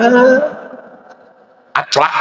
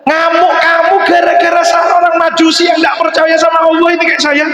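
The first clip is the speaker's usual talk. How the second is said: astonished outcry; 7 semitones higher than usual. Acted. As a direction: marvel that God defends him. Majusi astonished at God's anger.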